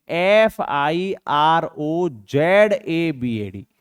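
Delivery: letter by letter, unhurried; slow spelling. Shown as a demonstration. Teacher spelling out Firozabad slowly for the class. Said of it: "spelling out for class".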